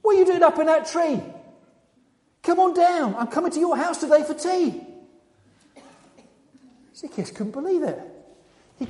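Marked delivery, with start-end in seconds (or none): 0.0-4.8 raised, high voice calling up to someone; loud, high hail from a distance. Acted. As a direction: call cheerily to someone up a tree. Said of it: "called him by name".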